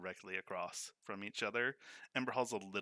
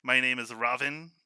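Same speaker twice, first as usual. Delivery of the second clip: louder, lightly voiced Ravin; a hint of a smug first mate; 3 semitones higher, 8 dB louder than usual. First mate Ravin answers crow.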